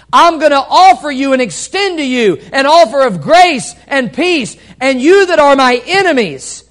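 full-force pulpit proclamation; fervent preaching. Acted. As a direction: preach it out with joy. Preaching climax on God's grace.

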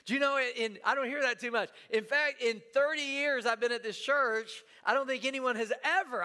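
normal talking voice

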